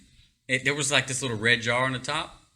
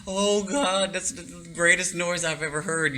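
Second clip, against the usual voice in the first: higher, relieved exclamation; relieved cry; 6 semitones higher than usual. Retold or imitated. Hearing the bathroom fan come back, relieved cry. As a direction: sigh out relief to God.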